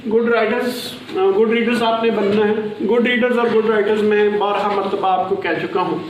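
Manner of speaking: ordinary talk, nothing marked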